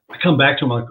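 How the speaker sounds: ordinary talk, nothing marked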